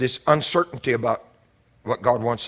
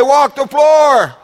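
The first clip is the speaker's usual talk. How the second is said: "Walk the floor" slow, high and shouted, hard fall on "floor"; shouted command; 13 semitones higher, 11 dB louder, much slower than usual. Shown as a demonstration. Preacher making the church repeat it, shouted slow and high. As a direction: bellow "Walk the floor" at them, drawing out "floor".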